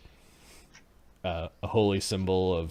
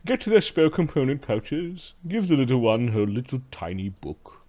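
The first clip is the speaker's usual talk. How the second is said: haughty slave master; 3 semitones higher, slower than usual. Acted.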